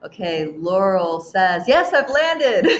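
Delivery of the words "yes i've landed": reading it out through a laugh. Real, not acted. Host laughing as she reads a viewer's message. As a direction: laugh while saying it.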